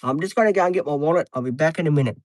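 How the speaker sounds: ordinary talk, nothing marked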